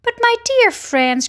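high, cheery cry; rally your friends with forced cheer; trying to be cheerful. A narrator's reading. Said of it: "trying to be cheerful".

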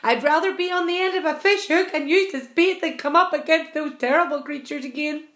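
high, loud, fretful outburst; frightened shriek. A narrator's reading. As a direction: protest loudly in dread. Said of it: "shrieked the blind earthworm".

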